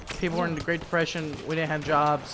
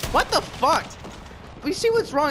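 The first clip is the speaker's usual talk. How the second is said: surprised outburst; 11 semitones higher than usual. Real, not acted. Reacting to game shot.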